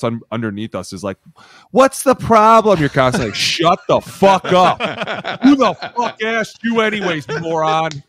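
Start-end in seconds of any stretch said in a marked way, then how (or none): 1.7-2.8 high, loud, drawn-out question; exasperated demand. Retold or imitated. Teammates underneath questioning the line, loud exasperated demand. 3.4-8.0 high, loud, drawn-out put-down; angry retort. Retold or imitated. Lineman shutting up a questioner, acted angry yell.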